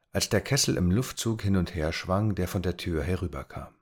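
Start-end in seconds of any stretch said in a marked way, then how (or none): none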